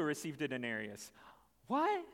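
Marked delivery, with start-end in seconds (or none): 1.7-2.0 surprised. Retold or imitated. Shocked worker at equal pay.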